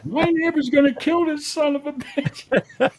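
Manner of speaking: mock shrieky neighbor on the phone; imitating panicked upstairs neighbor. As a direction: mimic a frantic woman phoning for help. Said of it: "The lady upstairs".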